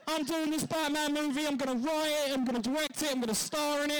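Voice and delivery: high, excited bragging Ben Affleck; hammed-up eager boasting; mock Batfleck. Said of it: "Batfleck was like".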